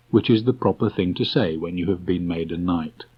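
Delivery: ordinary delivery, nothing marked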